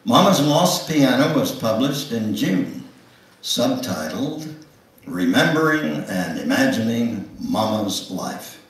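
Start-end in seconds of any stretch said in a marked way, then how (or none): none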